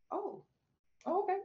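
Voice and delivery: a high laughing okay; laughing at God's unexpected answer; laughing surprise. Retold or imitated. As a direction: let the laugh break through the okay.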